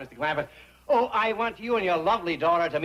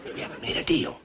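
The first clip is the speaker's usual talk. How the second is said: whispering; 13 semitones lower, 3 dB quieter than usual. Acted. Aside to Tommy, unvoiced.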